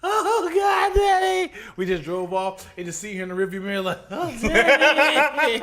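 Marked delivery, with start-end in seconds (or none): 0.0-1.5 high-pitched mock crying child; wailing little daughter. Imitating daughter crying as car leaves. 4.1-5.6 high, long drawn-out mock wail; wailing little daughter. Daughter crying in rearview mirror.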